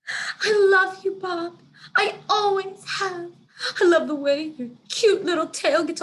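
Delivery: high, loud, trembling hammed-up declaration; melodramatic love confession. The cat confessing his love to Bob with dramatic sobs. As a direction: overact a tearful love confession. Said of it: "Snickers pauses for dramatic effect".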